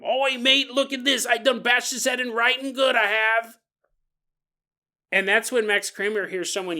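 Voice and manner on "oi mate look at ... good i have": higher put-on British lad accent, boasting; a light cockney brag; British teen accent. Teenager showing Max the skull, British accent. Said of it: "they show him".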